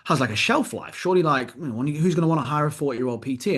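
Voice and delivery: high, fretful voice of a worried father; imitating worried dad. Son mimics his worried dad over drinks, high and fretful. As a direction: lift the pitch, play the anxious dad. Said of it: "He's like".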